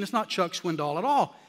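ordinary talk

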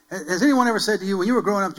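normal talking voice

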